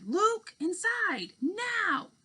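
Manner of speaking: high, slow mother's call calling Luke in; mother calling her son inside, urgent. Acted. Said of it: "He heard his mother's voice in his head".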